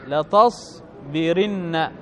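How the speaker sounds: drawn-out لتصبرن, i before the doubled n; kasra before nun. Shown as a demonstration. Teacher repeating the form for students, لتصبرن slow with kasra. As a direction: say لتصبرن very slowly as la-tas-bi-rin-na. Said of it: "يكسر ما قبل النون".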